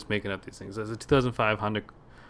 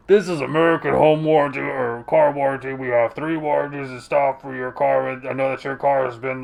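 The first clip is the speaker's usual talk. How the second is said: imitating a canned telemarketer, louder and monotone; robot salesman; 4 semitones higher, 7 dB louder than usual. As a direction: rattle off the script like a machine. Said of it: "this guy sounds like a fucking robot".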